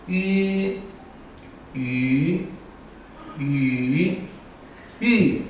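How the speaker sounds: walk 吁 through the four tones. Shown as a demonstration. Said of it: "再读一遍".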